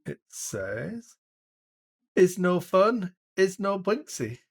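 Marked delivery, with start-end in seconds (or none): 2.1-4.4 put-on loud, high broken-English accent; terrible foreign accent. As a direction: read the doll label in a thick foreign accent. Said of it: "my terrible accent".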